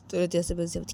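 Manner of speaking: usual speaking voice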